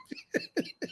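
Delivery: a high-pitched cackle; high laugh. Real, not acted. Laughing at his own taunts with a high cackle. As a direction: let a high giggle burst out.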